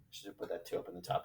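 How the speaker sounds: quick quiet remark to himself; said under his breath; muttered aside. Real, not acted.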